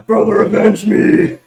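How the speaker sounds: high, loud dying plea laughing; overacted death scene. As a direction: overact a brother's last words. Mock dramatic death cry.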